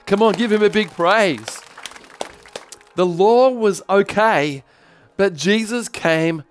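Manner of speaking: rousing call for praise; fervent preaching. Real, not acted. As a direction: whip the congregation up.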